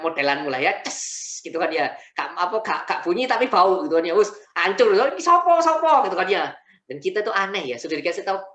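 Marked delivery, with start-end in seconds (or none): 4.9-6.1 excited outcry. Retold or imitated. People in meeting react to smell.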